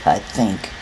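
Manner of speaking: ordinary delivery, nothing marked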